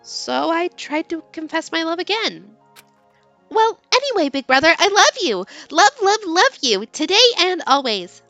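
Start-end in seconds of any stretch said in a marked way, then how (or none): 3.5-8.2 high, louder gushing confession; high lovesick girl. Acted. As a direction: pour out her love eagerly. Heroine voiced in read story.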